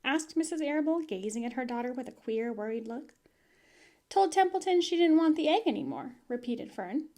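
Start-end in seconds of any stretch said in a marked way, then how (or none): 4.1-6.2 higher and louder, repeating herself; girl impatiently says it again; insistent girl. Acted. Fern repeats herself to her mother, higher and louder.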